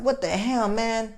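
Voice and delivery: high, soft exasperated wonder; hushed high-pitched disbelief; retold disbelief. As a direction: pitch it way up, kept quiet. Retells disbelief at her still acting.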